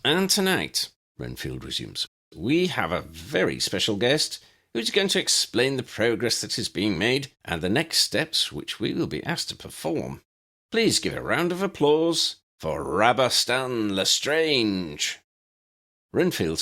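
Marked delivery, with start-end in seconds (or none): 0.0-0.9 announcer. Acted. Renfield announces guest. 2.3-15.2 announcer. Acted. Renfield announces guest.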